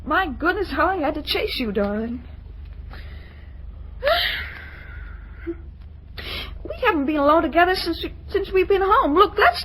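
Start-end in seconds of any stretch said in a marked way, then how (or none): 3.6-4.5 dreamy sigh. Acted. Daughter sighs after romantic thought.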